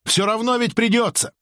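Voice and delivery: высоко и настойчиво, без лишней громкости; дави на собеседника взвинченным голосом; выкрик. A narrator's reading. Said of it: "выкрикнула Анги". (выкрикивая)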